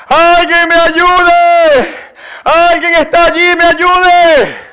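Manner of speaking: high, drawn-out yell; acted cry for help. Dangling climber yelling for someone to help, acted cry for help. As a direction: call out as if to someone far off, vowels stretched. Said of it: "comenzó a gritar".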